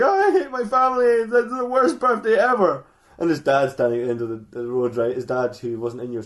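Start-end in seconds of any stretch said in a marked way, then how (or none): 0.0-2.8 mock crying. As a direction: bawl it out, hammed up. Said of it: "runs out of the house crying".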